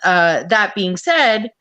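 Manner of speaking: ordinary talk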